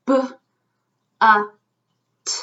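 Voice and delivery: B, uh, t as three isolated sounds. Shown as a demonstration. Teacher breaking a syllable into sounds, one by one. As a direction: separate each sound with long gaps. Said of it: "How many sounds?".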